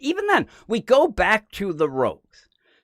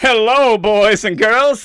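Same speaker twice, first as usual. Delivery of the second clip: showy villain entrance; 4 semitones higher, 9 dB louder, slower than usual. Retold or imitated. Villain struts in with cane.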